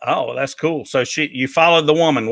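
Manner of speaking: ordinary talk, nothing marked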